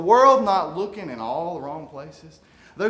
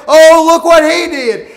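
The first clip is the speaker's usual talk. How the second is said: mock admiring crowd; 8 semitones higher, 12 dB louder than usual. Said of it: "people will just clap and say".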